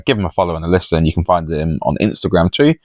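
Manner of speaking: ordinary talk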